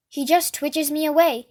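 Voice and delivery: ordinary delivery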